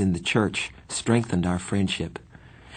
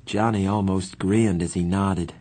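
plain reading voice

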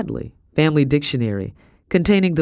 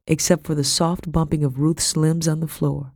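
ordinary delivery, nothing marked

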